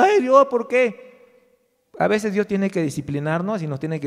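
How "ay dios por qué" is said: loud, high, drawn-out mock lament; mock crying out. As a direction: cry out to God like a complainer. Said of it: "no estemos gritando y diciendo".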